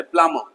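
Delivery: प्लम्बर said as प्लमर, no B; correct pronunciation. Shown as a demonstration. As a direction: drop the B in प्लम्बर. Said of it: "बी silent है". (सही उच्चारण, प्लम्बर में B मूक)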